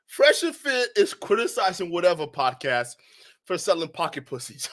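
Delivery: normal talking voice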